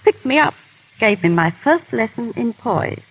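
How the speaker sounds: usual speaking voice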